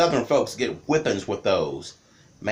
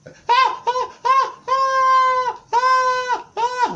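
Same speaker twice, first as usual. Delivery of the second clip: high falsetto mock screams, louder; kid screaming; 5 dB louder, much slower than usual. Child screaming while mama whoops him, high mock yells. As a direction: yelp like a kid being whooped. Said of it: "screaming on top of my lungs".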